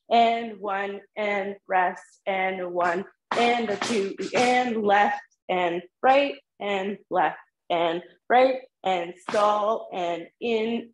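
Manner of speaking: slow, even counting for the class. Shown as a demonstration. Teacher counting the class in nice and slow. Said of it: "Let's count that in nice and slow together".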